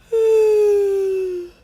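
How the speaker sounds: big yawn. Real, not acted. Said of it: "sorry for yawning".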